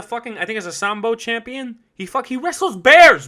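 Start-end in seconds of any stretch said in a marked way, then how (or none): 1.9-3.3 loud and high, "he wrestles bears"; excited. Real, not acted. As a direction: hype him up. Hyping Khabib's toughness.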